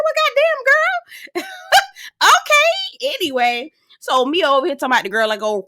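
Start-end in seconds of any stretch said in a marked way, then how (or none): 0.0-3.1 very high, slow, laughing wail; shocked laugh. Retold or imitated. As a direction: shriek with shocked delight. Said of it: "I said".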